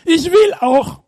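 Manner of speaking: put-on high childlike bitte bitte; eager begging. Peter begging Jesus to let him walk too, eager begging. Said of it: "Und deshalb sagt er".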